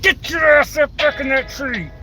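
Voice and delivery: high-pitched mock scolding; parental scolding yell. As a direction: call it out like ordering a kid indoors. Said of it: "Just like they're my kids".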